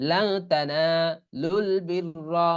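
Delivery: লান sung out with a humming nasal end, slowly; nasal ikhfa recitation. Shown as a demonstration. Teacher recites with ghunna to show the rule. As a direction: recite লান তানালুল বিররা stretched, hiding the n. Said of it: "তাহলে লাং হবে".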